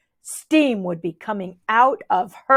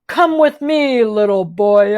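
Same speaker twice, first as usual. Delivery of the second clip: Captain Puff voice; 4 dB louder than usual. A narrator's reading. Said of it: "in my Captain Puff voice".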